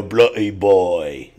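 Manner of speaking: slow, low, hammed-up British voice; mock British accent. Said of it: "Could you imagine that?".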